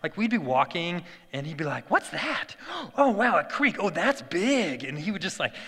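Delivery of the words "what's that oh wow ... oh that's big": put-on high-pitched kid amazement, stretching the last words; enthusiastic. Little son spotting a creek on the hike, imitated excitement. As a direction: imitate a wonderstruck little boy, high, with a gasp. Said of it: "like, enthusiasm for life".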